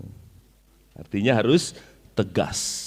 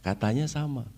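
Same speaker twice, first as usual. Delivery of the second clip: "Katanya sama" said softer, dropping away; a different intonation; 7 dB quieter than usual. Shown as a demonstration. Preacher showing the audience a different intonation, softer. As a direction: say "Katanya sama" softly, letting it fall. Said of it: "bisa jadi intonasinya berbeda".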